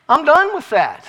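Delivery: loud, high declaration of being finished; retold outburst of firm refusal; emphatic resolve. Retold resolve against the show.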